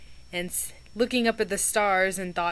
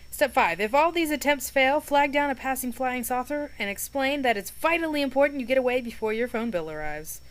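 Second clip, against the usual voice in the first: reading aloud; 5 semitones higher, 5 dB louder than usual. A narrator's reading. Reads the list aloud.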